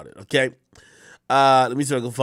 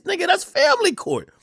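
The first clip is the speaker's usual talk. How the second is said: incredulous. Real, not acted. Incredulous reaction.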